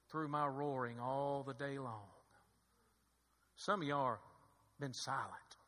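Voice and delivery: very low, soft pulpit voice; grave, hushed preaching. Acted. Preacher warning the church about silence, hushed and grave. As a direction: let each phrase sink in, with long pauses.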